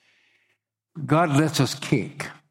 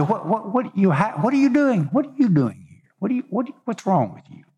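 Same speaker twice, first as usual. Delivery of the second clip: high, exasperated questioning as God; a baffled God asking what he's doing; 9 semitones higher, faster than usual. Acted. Preacher voices God questioning Elijah.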